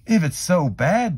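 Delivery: loud, high doubting question; skeptical boy. A narrator's reading. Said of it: "Jeremy asked".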